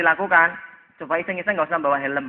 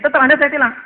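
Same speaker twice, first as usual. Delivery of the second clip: polisi ngotot, tinggi dan keras; tiruan polisi ngotot; 7 semitones higher, 6 dB louder, faster than usual. Acted. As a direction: putuskan tilang dengan suara lantang. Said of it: "kata polisi kan gitu ya". (memerankan polisi yang tak mau dibantah)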